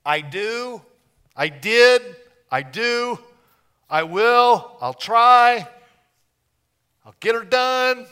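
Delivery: a hammed-up do-it-yourself believer; mock striving believer. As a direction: rattle off your efforts like a proud try-hard. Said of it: "Religion is about".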